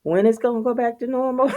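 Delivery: lightly voiced son; son's disbelief. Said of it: "He was like".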